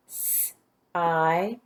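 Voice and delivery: "S-I-D" as separate sounds, sss, eye, d. Shown as a demonstration. Teacher gives the sounds in second syllable, segmented phonemes. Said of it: "Now the sounds in the".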